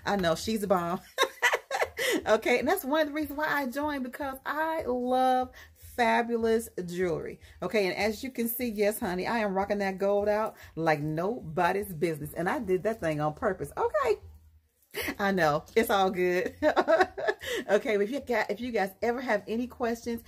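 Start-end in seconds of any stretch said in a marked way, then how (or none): 1.1-2.2 bright burst of high laughter. Real, not acted. Laughs at own joke. 16.6-17.7 quick high laugh. Real, not acted. Laughs after gold joke.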